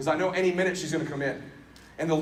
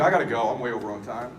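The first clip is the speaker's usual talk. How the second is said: ordinary talk, nothing marked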